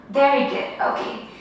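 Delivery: normal talking voice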